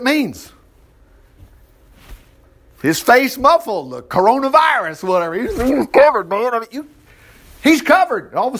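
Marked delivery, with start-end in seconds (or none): none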